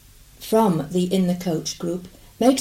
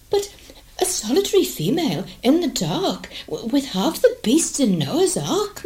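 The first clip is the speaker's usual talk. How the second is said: higher, indignant lady; 7 semitones higher than usual. Acted. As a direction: complain with offended dignity.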